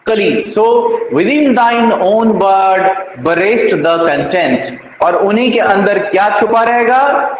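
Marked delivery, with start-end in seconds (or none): 0.6-4.6 very slow, weight on within; slow verse recital. Shown as a demonstration. Teacher reciting the next sonnet line to students, very slowly. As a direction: let each word of the line hang.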